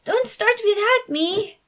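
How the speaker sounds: put-on high, slow, eager cry from a kid; a young girl bursting into the room; excited exclamation. A narrator's reading. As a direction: shout it while rushing in, afraid to miss out. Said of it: "Felicia exclaimed".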